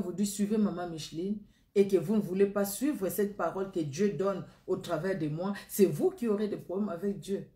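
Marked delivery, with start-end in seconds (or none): none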